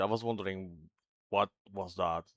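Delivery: ordinary talk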